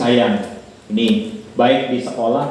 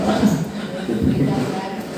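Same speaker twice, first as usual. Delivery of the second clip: breathy laugh; 7 semitones higher, 4 dB quieter than usual. Real, not acted. Laughing at joke.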